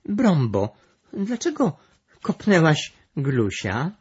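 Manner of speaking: high, loud astonished questioning; surprised. A narrator's reading. Malwinka asks Brąba why she kicked Gluś, surprised. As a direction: ask in amazement, pitch leaping up. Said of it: "zdziwiła się Malwinka".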